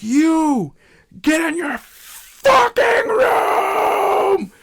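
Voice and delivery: high, loud, slow barked order; a staff worker yells at a kid to get to his room; retold yell. Yelling at kid in story.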